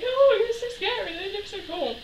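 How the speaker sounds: put-on high, fussing old dear voice; old ladies. Old neighbours fretting at the kids in the tree, fussing. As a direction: coo it like a fretting elderly neighbour. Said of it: "the old dears ... were like".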